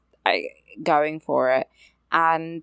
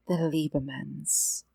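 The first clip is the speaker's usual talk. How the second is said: slow, softer, snooty mock drawl; mocking the lady; 8 dB quieter, slower than usual. Mocking a bride who name-drops her in-laws, slow and soft. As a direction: drag it out as a sneering imitation.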